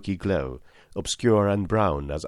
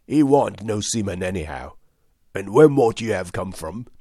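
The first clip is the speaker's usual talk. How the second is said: louder, rough pirate questioning; surly sailor growing louder; wounded pirate; 5 dB louder than usual. A narrator's reading. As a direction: push the question like a suspicious old salt. Pirate Hands questions Jim.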